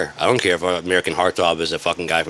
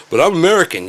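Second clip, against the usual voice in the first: high, loud indignant whine; indignant protest; 10 semitones higher, 5 dB louder than usual. Retold or imitated. American tourist at the Canadian border, indignant whine.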